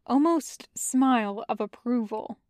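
plain reading voice

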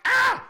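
very high, loud "Ah!"; mock panicked yelp. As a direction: imitate an alarmed bodyguard crying out. Retold Secret Service alarm.

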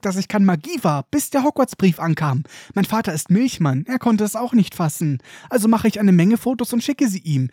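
put-on high, eager boyish babble; excited young Colin. Colin begs Harry for a signed photo, high and eager. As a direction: gush like a starstruck first-year.

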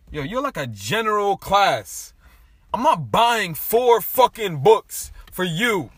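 slow, loud and high, half laughing; retold outraged rant. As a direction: rant at the class, refusing to pay. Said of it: "I got pissed".

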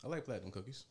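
soft, half-hearted praise; unenthusiastic. Real, not acted. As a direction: say it like you barely care. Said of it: "You don't sound too enthusiastic".

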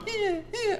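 small, very high imitation of a fussing baby; imitated baby whine. Father imitating his baby son fussing in the stroller, high whine. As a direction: squeak out a soft mock infant cry. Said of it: "Obi would start going".

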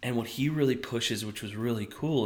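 usual speaking voice

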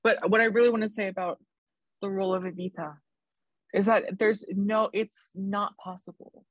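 ordinary talk, nothing marked

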